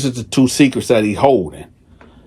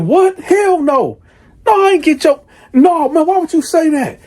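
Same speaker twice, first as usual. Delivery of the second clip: 1 shocked denial. Retold or imitated. Husband denies getting stepdaughter pregnant.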